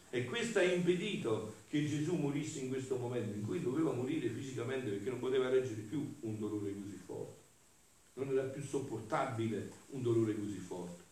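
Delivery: ordinary talk, nothing marked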